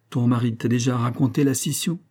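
ordinary delivery

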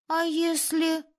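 put-on high, hesitant boyish voice; doubting boy. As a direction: venture a timid doubt. Doubting boy argues with leader. (мальчишка робко сомневается)

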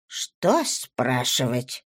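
slow, low mock surprise; feigned surprise. A narrator's reading. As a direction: play at being surprised. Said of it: "притворно удивилась Лукреция".

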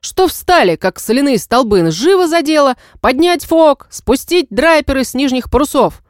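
fierce captain barking orders high and loud; furious shouting. Acted. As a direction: roar orders at the crew in fury. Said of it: "свирепо выругался". (яростный крик капитана)

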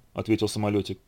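plain reading voice

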